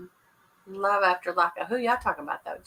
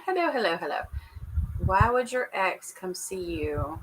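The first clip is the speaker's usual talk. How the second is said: ordinary talk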